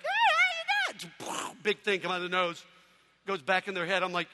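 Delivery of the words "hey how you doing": put-on squeaky very high, slow baby voice. Nursery baby greeting him, squeaky high baby voice. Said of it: "They're like".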